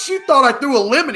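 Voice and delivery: high, loud mock disbelief; retold freak-out, voice shooting up. Boss freaking out to coworker about accusation, loud incredulity. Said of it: "he's freaking out".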